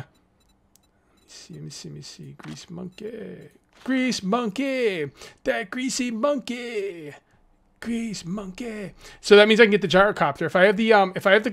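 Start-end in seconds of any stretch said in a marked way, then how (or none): 0.0-2.3 quiet muttering. Real, not acted. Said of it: "muttering to himself". 2.4-8.9 drawn-out savouring. Acted. Savouring perk name.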